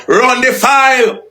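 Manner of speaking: high, slow, drawn-out mock order; mocking high quote. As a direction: drag it out high to mock him. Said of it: "you go".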